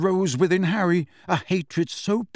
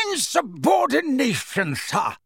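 hammed-up bellow, high and slow; a portrait wizard roaring outrage, mildly voiced; 10 semitones higher, slower than usual. A narrator's reading. Red-nosed wizard scolding Phineas, mock roar. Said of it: "roared a corpulent red-nosed wizard".

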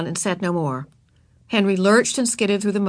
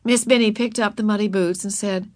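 usual speaking voice

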